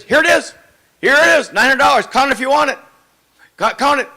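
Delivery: eagerly insisting he count the money; eager excited driver. Retold or imitated. Driver eagerly hands over money.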